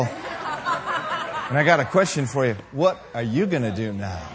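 dropped, knowing challenge to an enemy; low taunt. Acted. Believer asking the devil his own question, low taunt. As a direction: pose the question deep and restrained.

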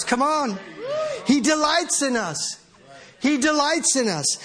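ordinary talk, nothing marked

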